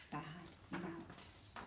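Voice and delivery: unvoiced, hushed; whisper. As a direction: breathe the words. Child whispers disbelief.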